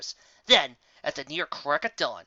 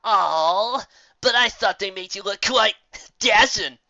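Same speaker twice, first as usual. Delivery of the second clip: louder mocking griffin, leaning on dashing; lightly voiced griffin teasing; 6 semitones higher, 7 dB louder, slower than usual. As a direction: tease her friend with a smirk.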